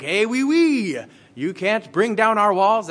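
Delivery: high, loud, hammed-up French accent; bad French accent. Cartoon peas taunting the Israelites from Jericho's walls, mock French accent. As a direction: taunt them in a silly fake French accent. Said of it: "That's a horrible French impression".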